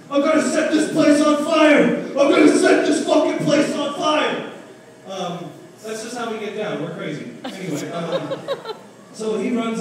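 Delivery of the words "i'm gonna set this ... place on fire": much higher, much louder yell; drunk screaming. Retold or imitated. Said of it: "running around the house screaming".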